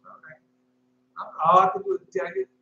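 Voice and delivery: ordinary delivery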